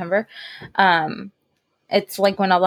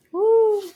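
high-pitched cheering "woo"; a quick, high whoop of approval; excited woo; 13 semitones higher than usual. Real, not acted. Co-host cheers the zombie topic, excited high woo.

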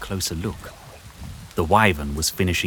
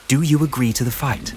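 put-on a bit higher, earnest girl's voice; lightly voiced young heroine; 7 semitones higher than usual. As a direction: reassure the beast, eager and sincere. Juliana voiced in story.

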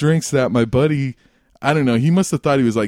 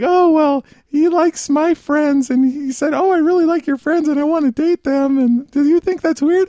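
mimicked woman; 13 semitones higher than usual. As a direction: mimic her, pitched way up and held there. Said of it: "And she was like".

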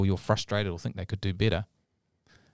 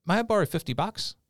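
put-on a touch of little-boy voice, higher; young son; 9 semitones higher than usual. Son asks daddy for money, lightly voiced higher. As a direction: lightly play a child asking a favour. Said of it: "then he says, "Daddy".

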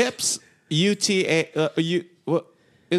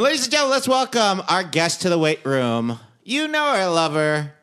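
higher, hammed-up ring announcer intro; mock announcer; 5 semitones higher than usual. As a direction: boom out the welcome like a host.

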